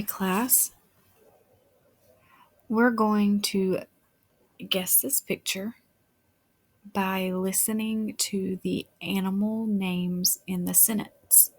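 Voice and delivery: ordinary delivery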